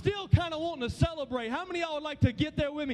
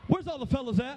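ordinary talk, nothing marked

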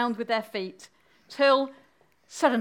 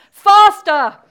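very loud, high urgent shout; crying out; 10 semitones higher, 14 dB louder than usual. Acted. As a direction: call it out at full force, pitched up high. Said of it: "for she cried".

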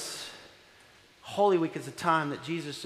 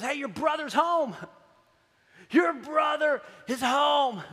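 excited servant; 8 semitones higher, slower than usual. Retold or imitated. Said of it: "He goes".

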